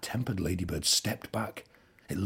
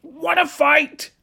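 challenging shout; 12 dB louder, slower than usual. A narrator's reading. Ladybird picks a fight.

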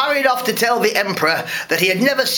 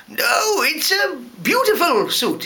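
uneasy praise; 8 semitones higher, slower than usual. Acted. Emperor pretending to admire suit.

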